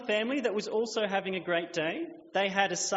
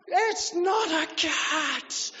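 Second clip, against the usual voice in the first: put-on high, loud, slow toddler meltdown; tantrum yell; 11 semitones higher, 5 dB louder, much slower than usual. Girl losing it at Violet, a high loud tantrum. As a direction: shriek it like a furious three-year-old. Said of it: "the little girl lost it".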